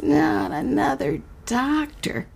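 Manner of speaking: put-on high, slow, dreading little girl; a frightened child pleading under her breath. Carney dreading another doctor, high and slow.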